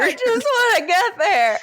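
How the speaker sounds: high-pitched whine through laughter; playful, laughing fake cry; mock whining. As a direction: join in with a hammed-up wail. Co-host joins the mock whining.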